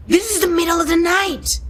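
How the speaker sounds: high, hammed-up teacher outburst; acting teacher. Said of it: "the acting teacher who being like".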